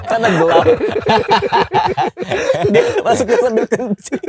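high voice squeezed out through laughter; laughing. Real, not acted. Comedian retelling the camper's fall, high laughing voice. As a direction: barely get it out while cracking up. Laughing at own retold story.

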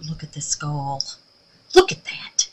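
hushed whisper over the skull; delighted secret whisper. Real, not acted. Showing off tiny skull.

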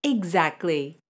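normal talking voice

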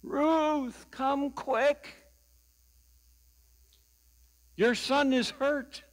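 high-pitched mock call for help. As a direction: imitate a helpless dad calling for his wife. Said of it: "he knew to call Mom".

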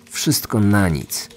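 usual speaking voice

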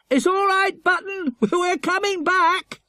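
high, loud call down to a dog; boy calling down to left-behind Button, reassuring. A narrator's reading. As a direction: reassure the dog from the window, slow.

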